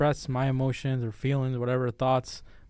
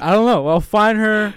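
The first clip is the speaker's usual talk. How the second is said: louder, higher playful vow; vowing to find his first love; joking vow; 7 semitones higher, 11 dB louder than usual. Real, not acted. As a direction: declare it boldly, half laughing. Loud joking declaration.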